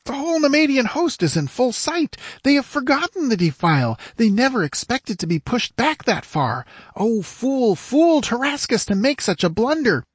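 high-pitched exultant squire. A narrator's reading. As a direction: crow over the enemy's blunder. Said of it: "shouted the squire exultantly".

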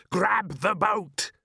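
high, drawn-out cry; yell. A narrator's reading. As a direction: yell it high and stretch each word. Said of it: "they both yelled".

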